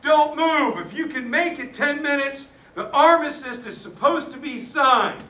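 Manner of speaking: loud, high call across the field; retold yell. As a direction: shout it out to all the men. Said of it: "a yell goes out".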